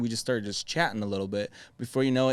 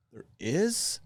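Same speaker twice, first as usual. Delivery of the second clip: naive surprise; 7 semitones higher than usual. Retold or imitated. New salesman told of a customer.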